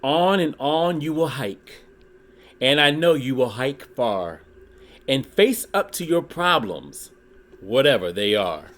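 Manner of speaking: lively read-aloud with rhyming bounce; storybook reading. Acted. Reading a Dr. Seuss book to his YouTube viewers, lively storytelling. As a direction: read it like a children's picture book.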